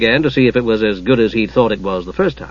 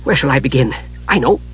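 lightly voiced Kender, lifting higher as the tale starts; a touch of the eager rambler; chatty Kender; 8 semitones higher than usual. A narrator's reading. Kender launches into his spoon story, eagerly chatty.

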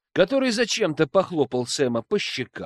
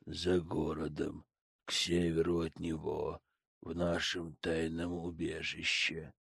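emotionless; 11 semitones lower, 13 dB quieter than usual. Acted. Hypnotized Sam answers flatly.

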